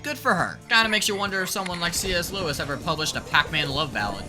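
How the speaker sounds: ordinary talk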